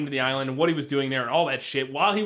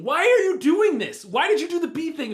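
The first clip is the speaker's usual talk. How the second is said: exasperated outburst; 11 semitones higher, 6 dB louder than usual. Real, not acted. Podcaster challenging the film's torturers, loudly exasperated. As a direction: raise your voice in incredulous protest.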